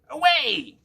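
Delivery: high shrill witch cry, very loud; shrilled witch command. A narrator's reading. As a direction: shriek it like a witch commanding her broom. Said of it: "shrilled her command".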